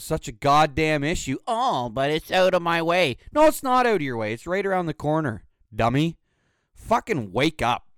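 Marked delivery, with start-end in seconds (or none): 1.5-3.2 a higher, put-on whine; lightly voiced whiny complainer; mock whiny excuse. 3.3-7.9 exasperated retort. Real, not acted. Retorting to imagined excuse.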